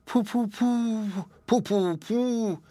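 tiny high piped sounds, slow; piping. A narrator's reading. Hare auditions as mourner for the bear, piping high and small. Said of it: "piped the hare".

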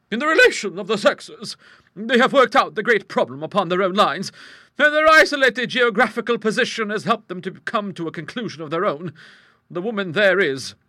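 high, loud, assured character voice; big-voiced matter-of-fact lady; strong-willed hostess. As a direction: explain it heartily and without concern. Hostess holds forth.

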